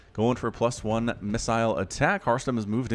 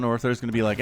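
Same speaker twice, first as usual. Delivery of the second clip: ordinary talk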